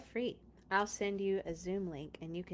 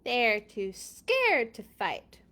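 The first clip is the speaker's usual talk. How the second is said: slow, louder jeering from the walls; mocking taunt; 6 dB louder, much slower than usual. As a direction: taunt the army below. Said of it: "the people in Jericho said".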